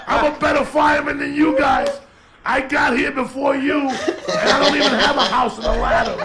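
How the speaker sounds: high, loud taunt from the would-be fireman; retold yelling. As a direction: shout it like boasting to rival firefighters. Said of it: "He'd be yelling".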